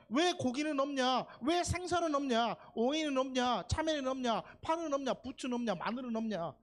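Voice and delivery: high-pitched list of complaints; mock grumbling. Preacher mimics Israelites missing Egypt's food, mock grumbling. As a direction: hammer out each grievance, easing off toward the end. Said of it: "이러고 있는 거예요".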